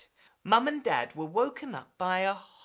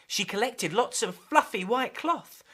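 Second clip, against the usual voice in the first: ordinary talk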